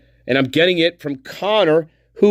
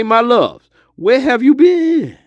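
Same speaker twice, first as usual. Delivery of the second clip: higher and louder, slow and sing-song; excited welcome; 6 semitones higher, 4 dB louder, slower than usual. Shown as a demonstration. Spirit greets the garden newcomers, higher, louder, slow welcome. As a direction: greet the newcomers with a big warm call.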